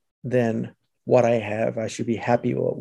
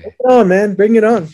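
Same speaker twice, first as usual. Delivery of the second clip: loud, high hype call; pumped up; 8 semitones higher, 8 dB louder, faster than usual. Real, not acted. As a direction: get fired up, bring it on. Hyped before the session.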